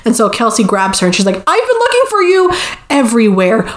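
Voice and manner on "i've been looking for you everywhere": high-pitched, gushing greeting; mock-urgent call to a friend; feigned eagerness. Grabs bridesmaid to escape conversation.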